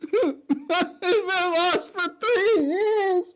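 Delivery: high, slow, laughing-crying wail; crying outburst. Acted. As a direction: break down mid-sentence. Knight breaks down crying.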